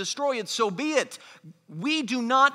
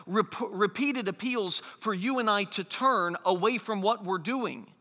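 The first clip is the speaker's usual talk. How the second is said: ordinary delivery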